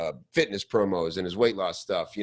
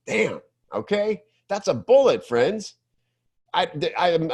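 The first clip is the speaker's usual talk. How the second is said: higher, a burst of delight; impressed; 8 semitones higher than usual. Real, not acted. Coach praises a student's bullet to listeners, impressed. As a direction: savour how good it is.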